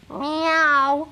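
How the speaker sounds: cat meow. Acted. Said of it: "does the wolf sound like this?".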